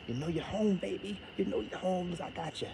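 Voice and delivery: soft sweet talk. Acted. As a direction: croon it like a lover. Sweet-talking YouTube as home.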